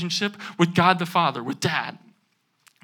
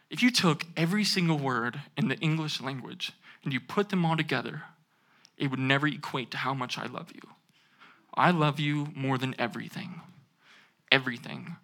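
soft, much quieter loving father; tender father; 5 semitones lower, 10 dB quieter than usual. Retold or imitated. The film's father softly telling his son he loves him.

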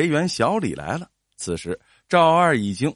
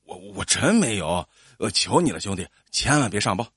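lower, stammered plea; pleading; 6 semitones lower than usual. A narrator's reading. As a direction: beg anxiously not to be reported. Culprit keeps begging the official. (压低声音苦苦央求)

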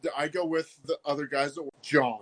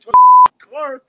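far higher, loud, put-on voice of a pushy street woman; crackhead woman voice; 19 dB louder than usual. Crackhead woman demanding to get into the car, shrieked high. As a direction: caricature her sudden loud shriek. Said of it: "this fucking crackhead broad's like".

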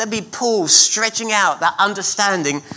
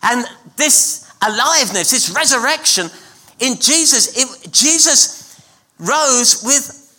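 higher, louder, fast rousing preaching; full-force preaching; 9 semitones higher, 4 dB louder than usual. Real, not acted. Preacher proclaiming the resurrection to the church, at full force. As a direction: fire up the congregation.

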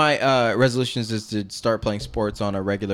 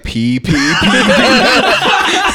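laughing; 8 semitones higher, 11 dB louder, much slower than usual. Retold or imitated. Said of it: "I walk up to somebody, like".

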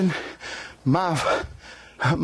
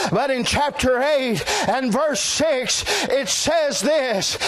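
preaching at full force; 7 semitones higher than usual. Real, not acted.

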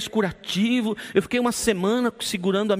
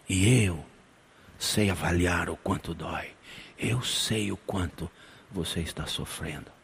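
God comforting; 9 semitones lower, 4 dB quieter than usual. Acted. God comforting the suffering listener, low and soft. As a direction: speak as God, gently and low.

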